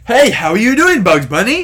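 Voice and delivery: loud, high, hammy movie line; bad acting. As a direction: overact it like a bad actor. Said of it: "the worst acting I've ever seen".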